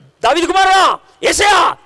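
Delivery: high, loud desperate cry to be heard; blind beggar shouting. Retold or imitated. Blind beggar calling to Jesus over the crowd, loud desperate shout. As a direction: call out over the crowd at full voice. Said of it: "ఒకడు అరుస్తున్నాడు".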